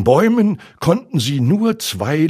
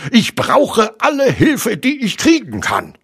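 high, irritated complaint; cross and flustered; barked; 13 semitones higher, 4 dB louder than usual. A narrator's reading. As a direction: bark it in a harried, pitched-up voice. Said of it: "blaffte Rabbit".

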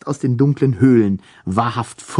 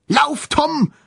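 calling out. A narrator's reading. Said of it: "rief Frau Kümmelsaft".

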